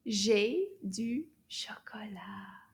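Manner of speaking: slow, word-by-word French modelling; drawn-out words with gaps between; slow teacher model. Shown as a demonstration. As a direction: say it slowly for learners to repeat. Teacher models sentence for repeating.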